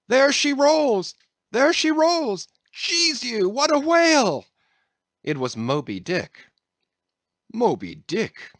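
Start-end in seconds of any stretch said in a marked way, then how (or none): 0.1-4.5 high, loud sighting cry; shouted out. A narrator's reading. Sailor spotting the whale yells to the crew, high and loud. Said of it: "all at once shouted out". 7.5-8.5 cried out. A narrator's reading. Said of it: "cried Don Sebastian".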